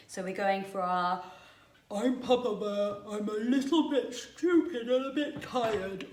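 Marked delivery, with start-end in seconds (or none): 1.9-6.1 slow, lightly dopey yawny bear voice; a hint of a sleepy dopey bear; dopey Papa Bear. Shown as a demonstration. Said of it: "dopey voice, yawny Papa Bear".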